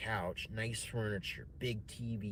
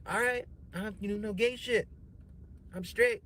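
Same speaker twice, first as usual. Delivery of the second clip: indignant protest; 9 semitones higher than usual. Retold or imitated. Broke comic telling himself he's straight, high indignant. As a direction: protest his straightness in a raised voice.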